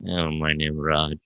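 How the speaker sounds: low, quiet, slow imitated applicant; struggling job applicant. Applicant from Goa answering a recruiter's question, slow low mumble. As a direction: answer haltingly in poor English, voice dropped low. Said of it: "And then he goes".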